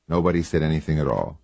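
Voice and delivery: plain reading voice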